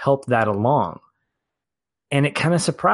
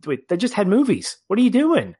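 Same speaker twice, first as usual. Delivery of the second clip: incredulous; 10 semitones higher than usual. Retold or imitated. Fan reliving the theatre reaction, high incredulous protest. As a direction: demand an answer in shock.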